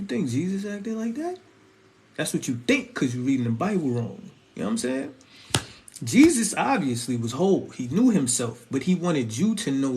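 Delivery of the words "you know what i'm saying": ordinary talk, nothing marked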